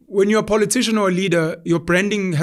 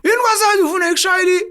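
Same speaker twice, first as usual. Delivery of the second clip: higher, fast, indignant mother; mom's sharp retort; 11 semitones higher, 3 dB louder, faster than usual. Retold or imitated. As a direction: fire it back like an offended mom. Mom's retort voiced in story.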